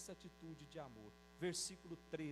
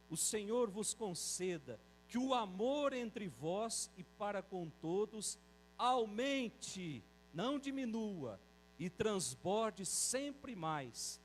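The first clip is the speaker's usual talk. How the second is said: slow, louder, drawn-out proclamation of the verse; solemn scripture reading; 2 semitones higher, 5 dB louder, slower than usual. Acted. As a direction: proclaim the blessing slowly to the congregation. Reads Bible verse, slow, loud. (leitura bíblica solene, lenta e mais forte)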